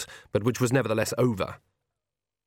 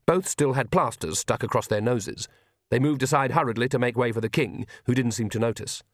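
ordinary delivery, nothing marked

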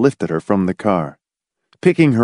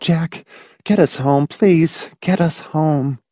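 high, jangled pleading; jangled plea; 8 semitones higher than usual. A narrator's reading. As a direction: plead with him on jangled nerves. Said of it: "in a low, jangling voice".

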